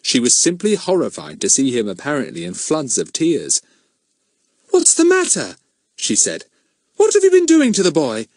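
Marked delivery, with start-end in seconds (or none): 4.7-8.3 alarmed mother. A narrator's reading. Horrified mother scolds children.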